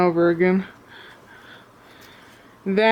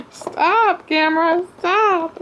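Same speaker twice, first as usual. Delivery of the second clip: much higher playful exasperated cry; yelling at the camera; 10 semitones higher, slower than usual. Real, not acted. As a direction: shriek at the camera to stop. Camera keeps misbehaving, yelling at it.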